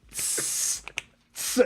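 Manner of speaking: quiet, sneaky imitated fizz; soft seltzer hiss. Still opening seltzer after the dad complained, quiet imitated hiss. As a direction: hiss the bottle softly, trying to hide it. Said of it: "I'm like".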